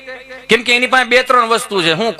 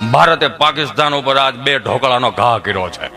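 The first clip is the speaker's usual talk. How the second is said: mock news bulletin; 9 semitones lower than usual. Mock news headline joke.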